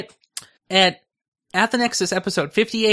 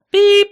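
beep noise; 11 dB louder than usual. Pretend. Imitates a stop beep.

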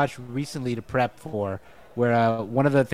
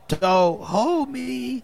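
Simpsons voice; 9 semitones higher, much slower than usual. Retold or imitated. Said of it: "Simpsons is one".

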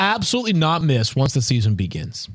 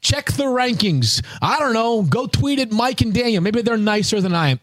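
exasperated rant; 11 semitones higher than usual. Retold or imitated. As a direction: vent the annoyance, high and pushed. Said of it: "I'm like".

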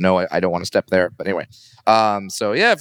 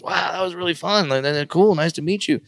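1 lightly voiced Jericho; 10 semitones higher than usual. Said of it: "he goes".

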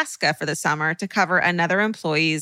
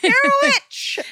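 very high, drawn-out shriek; mock screaming accusation; 4 dB louder, slower than usual. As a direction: scream it like a hammed-up witch hunter. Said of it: "I would have screamed at the top of my lungs".

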